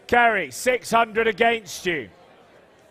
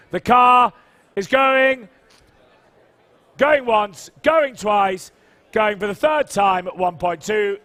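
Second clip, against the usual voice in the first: loud; 10 dB louder, slower than usual. Real, not acted. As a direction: belt out going once, going twice. Hammer call, going once twice.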